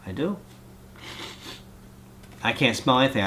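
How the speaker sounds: normal talking voice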